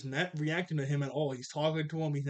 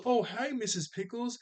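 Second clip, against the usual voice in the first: higher-pitched acted child's hello; mock-sweet greeting; 8 semitones higher than usual. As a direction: lightly put on a polite kid greeting a grown-up. Child greets friend's mother, retold.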